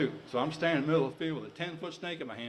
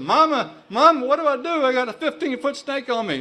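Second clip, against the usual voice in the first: put-on high, loud, panicked boy's cry; panicked child calling mama; 11 semitones higher, 10 dB louder than usual. Nine-year-old boy begging mama for help with the snake, high panicked shout. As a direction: shout for mama like a terrified kid.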